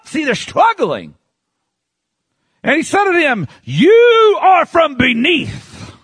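high-pitched, emphatic pulpit delivery; fiery preaching. Acted. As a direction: read scripture aloud with preaching fervor. Preaches at full force.

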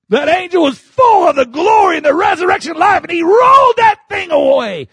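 high, loud preaching shout; full-force preaching. Acted. Preacher describing the angel, at full force. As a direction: ring it out to the rafters.

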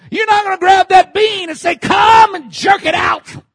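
high, loud, forceful warning; full-force pulpit holler; preaching shout. Real, not acted. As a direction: shout it as if yanking something loose. Acts out yanking the bean.

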